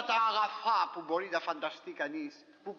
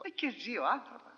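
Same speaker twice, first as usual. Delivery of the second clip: normal talking voice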